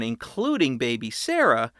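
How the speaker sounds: ordinary delivery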